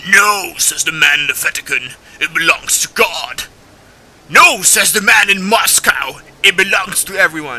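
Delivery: loud mock-grand orator; booming imitation of a game's intro monologue; Andrew Ryan. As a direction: belt out a dramatic speech, hammed up. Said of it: "that intro that Andrew Ryan tells you".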